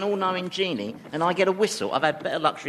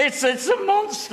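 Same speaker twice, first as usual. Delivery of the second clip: frightened. Acted. Monster appears, mock terror.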